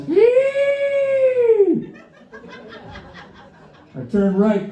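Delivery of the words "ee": high piercing mock squeal of the car; imitated car squeal. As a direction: squeal like a worn belt, way up high. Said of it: "the car goes".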